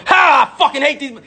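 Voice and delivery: imitating a hateful shout; mock hateful shout. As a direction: spit it out like a sneering bigot. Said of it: "racism is on".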